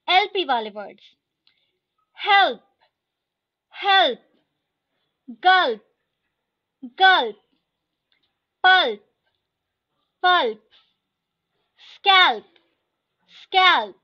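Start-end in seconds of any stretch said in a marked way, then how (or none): 2.2-14.0 each word said twice, loud and crisp; teacher drilling vocabulary, clear and loud; word drill reading. Shown as a demonstration. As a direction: read the list aloud for the class to repeat. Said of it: "मैं line by line ऐसे पढ़ते जाऊंगी".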